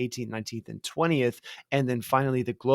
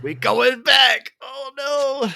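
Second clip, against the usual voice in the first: mock dread; 12 semitones higher, 8 dB louder, much slower than usual. Echoing the dread, put-on voice.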